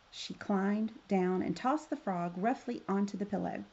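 ordinary delivery

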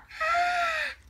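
high gasp of delight. Acted. George seeing his paper boat done, delighted gasp.

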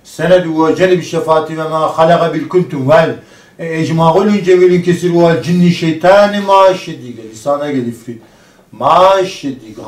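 steady chanted Arabic prayer; prayer recitation. Acted. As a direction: recite the verses in a rhythmic drone. Recites ruqya prayer again.

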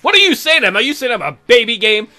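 high, loud indignant protest; offended outrage. Real, not acted. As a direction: play it mock-outraged at the insult. Offended by the game.

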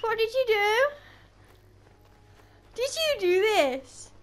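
real high-pitched, baffled questioning; high, drawn-out incredulous outbursts; shocked disbelief. Real, not acted. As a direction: react in bewildered alarm, voice shooting right up. Returns to find horse moved.

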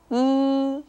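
loud, held "い" with lips drawn to both sides; spread-lip Mandarin i. Shown as a demonstration. Teacher demonstrating after the tip, long loud spread-lip "い". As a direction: pull the lips wide and sustain "い". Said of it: "両側に引くような感じで".

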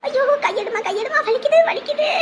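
high, hammed-up cries of pain; faked pain cry. Mother-in-law fakes pain to daughter-in-law, high hammed-up cries. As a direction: overplay the pain, pull away. Faking an injured leg.

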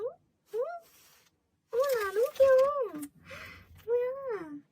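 high loud squeal of cuteness; squealing cute. Real, not acted. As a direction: gush like you saw a puppy. Sees cute card.

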